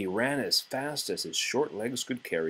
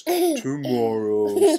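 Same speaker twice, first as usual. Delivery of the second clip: slow, drawn-out tomorrow, louder; lazy Toad; 4 semitones higher, 9 dB louder, much slower than usual. Acted. Toad putting off the chores, long drawn tomorrow. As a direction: stretch it out like a lazy whine. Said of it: "said Toad".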